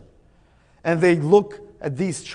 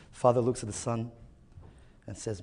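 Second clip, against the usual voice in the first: ordinary talk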